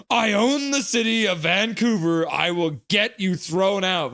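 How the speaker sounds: high, hammed-up blustering threat; mock threat. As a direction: act out a self-important ultimatum. Acts out a viewer's threat.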